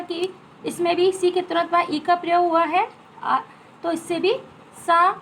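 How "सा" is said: सा voiced loud and drawn out as a clear "saa"; loud teacher demo of सा; sound sa. Shown as a demonstration. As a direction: stretch out सा with a crisp s. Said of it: "हम उसको सा से पढ़ेंगे".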